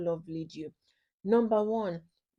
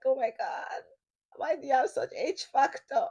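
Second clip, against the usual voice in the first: mock annoyed partner; 4 semitones higher than usual. Mocking partner's accent annoyance.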